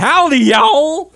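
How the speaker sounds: cartoonish Southern cowboy greeting, drawn out; Texas drawl. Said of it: "We're from Southeast Texas".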